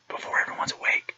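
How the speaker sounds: hushed early-morning whisper. Retold or imitated. Telling his quiet morning before the house wakes, whispered. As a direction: breathe it out, not waking anyone.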